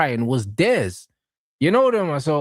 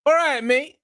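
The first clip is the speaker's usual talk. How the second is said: mock greeting; 12 semitones higher than usual. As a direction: yell it up high like a smug rival. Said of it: "they were like".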